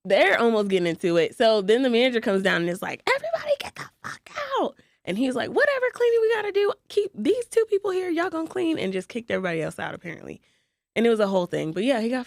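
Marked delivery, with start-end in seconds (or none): 3.1-4.8 high-pitched imitation of an angry manager; mock manager yell. Imitating the manager kicking everyone out, in a high mock yell. Manager yelling in retold story. 5.5-8.8 high imitation of a bossy manager; mock manager voice. Imitating the manager ordering two closers to stay, pitched high.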